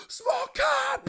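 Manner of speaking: very high, loud mock squeal; so hyped. Ice cream worker cheering a small cup order, wildly hyped. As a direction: shriek it in hammed-up delight. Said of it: "just so hyped about everything".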